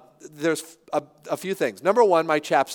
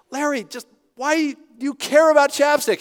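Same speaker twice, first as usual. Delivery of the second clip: higher, louder, mock exasperated wife voice; teasing wife; 8 semitones higher, 6 dB louder than usual. Wife mocking his chapstick worry.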